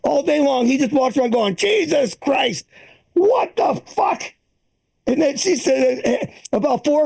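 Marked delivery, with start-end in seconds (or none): 1.5-4.3 put-on slow, higher cranky old man swearing; grumpy old father-in-law. Comic imitating his father-in-law grumbling as he wanders round.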